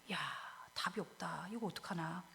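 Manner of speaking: low, hushed muttering to oneself; hopeless murmur. Acted. King thinking there is no way out, hopeless murmur. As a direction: sink into soft, helpless resignation. Hezekiah's thought of having no answer.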